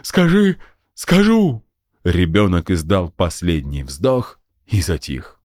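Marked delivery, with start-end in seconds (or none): 0.0-1.6 hammed-up dying child's last words; death groan. A narrator's reading. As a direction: gasp last words like a kid faking death. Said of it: "симулируя предсмертную агонию".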